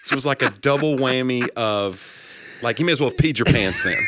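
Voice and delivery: ordinary delivery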